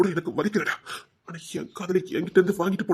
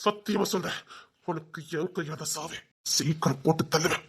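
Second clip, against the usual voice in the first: slow, much softer menace; villain vowing revenge, softer and slow; quiet death threat; 9 dB quieter than usual. Acted.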